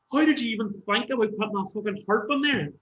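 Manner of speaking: high, astonished question; amazed. Retold or imitated. Asking the bandmate how he thought of a harp, high and amazed. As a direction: ask it in happy disbelief.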